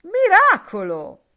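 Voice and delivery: a big high shout of wonder. A narrator's reading. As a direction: cry it out as a miracle. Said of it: "e gridò". (grida con stupore)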